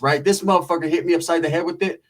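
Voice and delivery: ordinary talk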